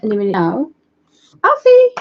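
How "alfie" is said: loud, very high shout; calling out. Real, not acted. Owner shouts for her missing dog, calling out. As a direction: call the dog sharply up high. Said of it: "If we shout him".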